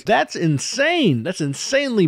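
high, slow, stretched disbelief; incredulous. Real, not acted. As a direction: drag it out in disbelief. Exasperated at plot.

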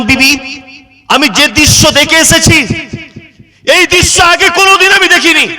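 high, impassioned mock weeping; acted tearful lament. Jaber telling his wife what he has seen, high tearful lament. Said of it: "কাঁদতে কাঁদতে বলছেন".

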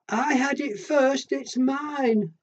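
high and slow, insisting; little animal voice. Acted. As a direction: whine it out like a small child. Little animal claims the chair.

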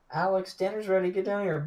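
mom calling up from downstairs; from another room. Retold or imitated. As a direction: call him down like a mom. Mom calling her gamer son.

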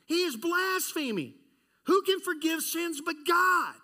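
rising outrage ending in a shout; indignant scribes. Acted. Preacher acting the scribes accusing Jesus, building to a shout.

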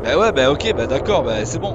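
protestation plus forte; râle fort, agacé; exaspération criée. Real, not acted. Réagit au cri du dino. (ras-le-bol crié)

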